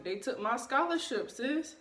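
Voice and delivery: ordinary talk, nothing marked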